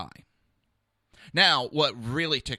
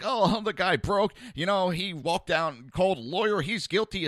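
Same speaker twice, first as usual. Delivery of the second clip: higher, louder, laughing mockery of gloating cops; mocking troopers; 8 semitones higher, 6 dB louder than usual. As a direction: gloat like a cop who thinks he cracked it.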